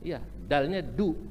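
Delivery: usual speaking voice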